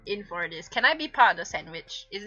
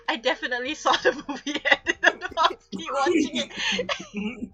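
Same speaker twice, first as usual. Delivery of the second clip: laughing while reading; 6 semitones higher than usual. Acted.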